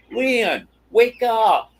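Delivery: mock wake-up yell, pitched well up; retold yell. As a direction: imitate mom yelling to wake dad, high-pitched. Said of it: "gets right up by him and yells".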